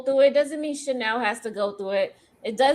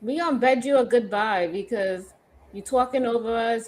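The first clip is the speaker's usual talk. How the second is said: usual speaking voice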